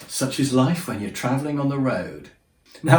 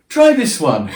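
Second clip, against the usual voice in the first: laughing offhand; 6 semitones higher, 7 dB louder, slower than usual. Retold or imitated. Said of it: "and she went".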